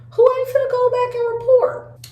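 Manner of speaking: high-pitched rhetorical question; incredulous high pitch. Real, not acted. Storyteller on coworkers passing gossip back, high incredulous voice. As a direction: shoot the voice way up, as if obvious.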